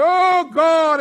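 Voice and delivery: loud high cry of prayer; crying out to God. Retold or imitated. Pastor retelling his prayer, loud cry. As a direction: call out to God from the chair. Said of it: "I'm getting down on my face".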